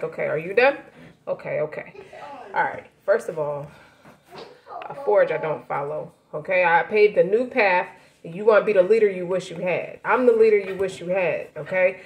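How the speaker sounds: the calm one who waited his turn; unbothered comeback. Acted. Calm rapper answering hyped opponent.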